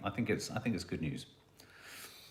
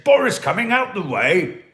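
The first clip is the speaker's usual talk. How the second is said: loud, slow, higher blustering shout; Boris impression; 8 semitones higher, 9 dB louder than usual. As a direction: bellow it like a bumbling toff. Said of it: "And he said".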